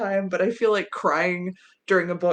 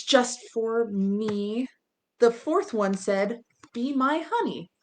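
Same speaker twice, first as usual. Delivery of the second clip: lively sing-song rhyme reading, character lines lifted higher; reading a rhyme to children; 4 semitones higher, slower than usual. A narrator's reading. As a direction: read the Valentine rhyme to little kids. Reads a children's rhyme aloud.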